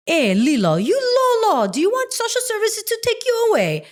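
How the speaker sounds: sing-song Hawaiian pidgin, pitched high; Hawaiian pidgin lilt. Retold or imitated. Stitch actor showing the directors his Hawaiian pidgin lilt. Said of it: "a little bit of a sing-song, in the pidgin English".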